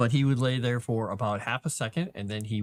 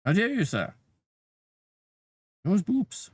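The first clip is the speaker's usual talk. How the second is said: high, indignant cat voice; 9 semitones higher than usual. Retold or imitated. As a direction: play the offended cat, lifted high. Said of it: "and he's like".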